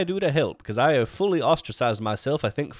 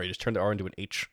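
ordinary talk

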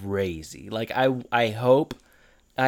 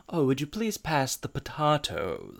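softer, over-polite dinner-table request; mock proper politeness; 3 semitones higher, 5 dB quieter than usual. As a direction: imitate overly proper table manners. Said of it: "doing the whole, like, proper thing".

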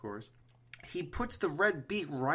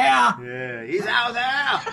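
loud, higher, laughing and slow; mock ominous shout; 8 semitones higher, 9 dB louder, much slower than usual. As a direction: shout the warning, half laughing. Mock horror joke.

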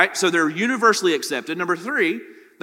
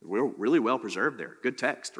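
a lightly mocked, offhand yeah great, much softer and lower; mock approval; 7 semitones lower, 9 dB quieter than usual. Pastor imitates university people praising the Iliad, casually dismissive. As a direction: brush it off like a bored professor. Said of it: "they're gonna be like".

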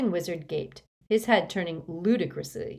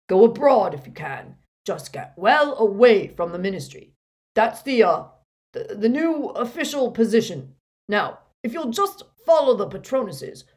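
a louder, firm official addressing a crowd; Runcorn's commanding voice; 4 semitones higher, 8 dB louder than usual. A narrator's reading. Harry disguised as Runcorn, directing Muggleborns, firm and loud. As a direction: steer the frightened group out with authority.

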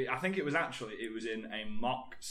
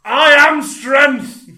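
slow, much louder bellowed war cry; screaming strongman; 13 semitones higher, 22 dB louder, much slower than usual. Retold or imitated. Strongman screaming into the microphone, slow bellow. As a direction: scream it into the mic like a champion. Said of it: "starts screaming into the microphone".

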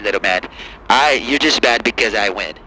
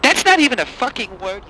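much higher, outraged retort; angry liberal caller; 10 semitones higher than usual. Acted. Caller mocking the president's word in a much higher voice. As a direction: snap back, voice jumping up. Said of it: "Angry Lib".

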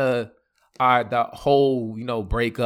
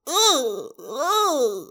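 drawn-out mock whimper; long, high, comic groan of heartbreak; wavering distressed moan; 12 semitones higher than usual.

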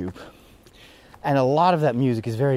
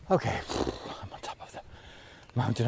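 breathless after climbing stairs; panting from the climb; out of breath; 3 semitones lower, 10 dB quieter than usual. Real, not acted. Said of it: "I'm completely out of breath".

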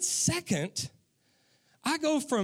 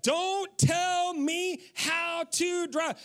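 slow, drawn-out, higher mock scolding; 7 semitones higher, much slower than usual. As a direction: spell it out slowly to the wife, half-joking. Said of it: "I look at her and I'm like".

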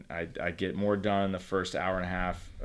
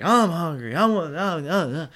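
put-on high, loud whiny child voice; whining kid; 8 semitones higher, 8 dB louder than usual. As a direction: whine like a hungry little kid, pitched way up.

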